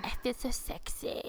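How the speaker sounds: breathy mock sexy whisper; sultry whisper. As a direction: whisper it like you feel sexy. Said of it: "you're like".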